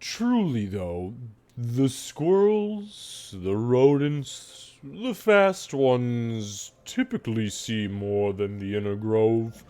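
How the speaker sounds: very slow, swooping cow voice; ponderous cow sharing what it knows; talking cow. Acted. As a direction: draw out each phrase like lowing. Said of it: "she says".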